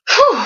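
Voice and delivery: high, breathy swoon of a whew. Real, not acted. Author swooning over her character Riley, whew. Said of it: "I think I need to lie down".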